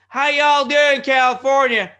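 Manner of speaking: high, booming Texan greeting; loud, expressive cousin. Retold or imitated. Imitating his Texas cousin on the phone, loud and expressive. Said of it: "She's very loud and expressive".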